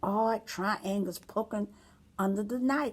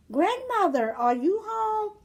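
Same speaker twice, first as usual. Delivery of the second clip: put-on much higher child's call; girl calling; 13 semitones higher, 3 dB louder than usual.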